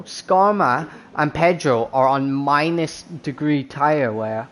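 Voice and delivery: ordinary delivery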